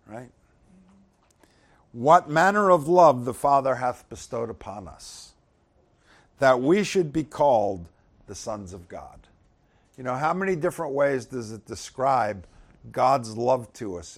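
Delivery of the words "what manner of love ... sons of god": scripture reading. Acted. Preacher reading First John aloud to the church, solemn and loud. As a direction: read out the verse loud and solemn.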